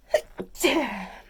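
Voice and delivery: a sudden loud sneeze; hard real sneeze. Real, not acted. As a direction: let out a full sneeze. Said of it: "gonna sneeze".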